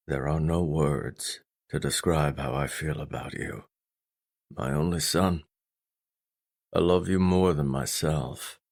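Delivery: low, heartfelt father; quietly loving fatherly confession; stern father, tender. Acted. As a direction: tell your son you love him, low and restrained.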